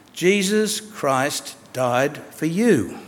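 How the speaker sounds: very slow, solemn divine voice; voice of God. Retold or imitated. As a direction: say each word slowly, as God's voice. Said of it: "The voice said to him".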